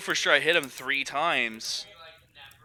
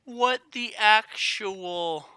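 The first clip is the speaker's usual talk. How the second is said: drawn-out disbelief; 9 semitones higher, 4 dB louder, much slower than usual. Real, not acted. Trailing outrage.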